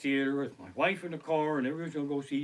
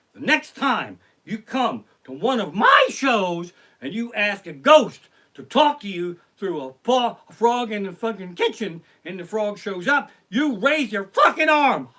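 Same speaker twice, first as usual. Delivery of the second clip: loud, high mock tirade; angry scolding; 6 semitones higher, 10 dB louder than usual. Comic acts out psychic berating him after the show, angry shouting. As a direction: chew him out, building to a yell.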